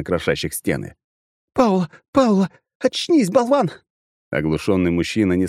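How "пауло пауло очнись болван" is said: high loud desperate yell; frantic shouting. Acted. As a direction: scream him awake. Partner shakes stunned guard.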